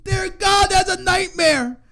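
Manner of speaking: loud, slow, high cry of dismay; horrified shout. Real, not acted. Friend cries out to God at the commentary news, loud and high. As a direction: drag out the horror, loud and high.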